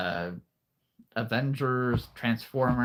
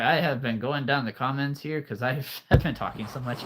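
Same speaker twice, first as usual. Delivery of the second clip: ordinary talk, nothing marked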